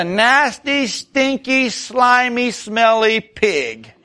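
comic revulsion building to a slow, laughing end; mock disgust. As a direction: relish every gross word. Preacher describing the pig.

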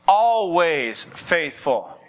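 "always" drawn out slow, then "faithful"; stress on ALWAYS. Real, not acted. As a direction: stretch the word "always" long and slow. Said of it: "you gotta emphasize the always".

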